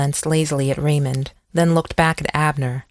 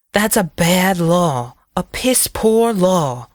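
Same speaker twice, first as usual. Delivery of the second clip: slow, higher-pitched scornful emphasis; bitter contempt; 3 semitones higher, slower than usual. A narrator's reading. Sheriff condemns the law that ties his hands, with bitter contempt. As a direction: draw out each verdict with disgust. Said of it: "with bitter contempt".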